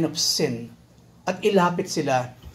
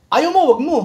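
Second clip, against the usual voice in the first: high, loud mock retort; pitched-up sassy comeback; defiant retort; 9 semitones higher, 6 dB louder than usual. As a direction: snap back like a proud woman. Woman voiced answering back.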